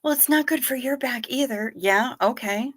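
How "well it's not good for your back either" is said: a hint of mom, pitched higher; lightly voiced mom pushing back; quoting mom. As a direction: lightly voice mom's objection. Mom's retort quoted.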